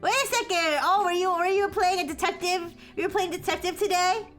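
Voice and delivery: high, bright cute greeting voice; cute anime streamer. Real, not acted. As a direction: squeal hellos to the raiders.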